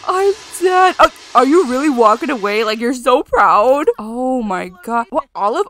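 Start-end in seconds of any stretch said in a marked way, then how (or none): 0.1-3.9 loud disbelief. Real, not acted. Sim causes fire.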